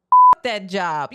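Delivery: loud, slow mock shout of the brothers; retold yell. Brothers yelling at the husband, a loud slow mock shout. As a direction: belt it out like the egging-on brothers. Brothers urging him to quit.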